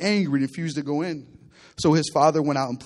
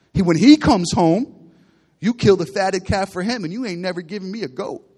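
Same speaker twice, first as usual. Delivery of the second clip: bitter protest; 3 semitones higher, 7 dB louder than usual. Acted. Older son protests to his father.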